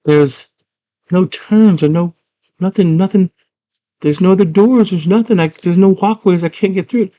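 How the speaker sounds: high mock-girl complaint; girl's voice. Girl telling the worm she's stuck, high voice. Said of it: "she's like".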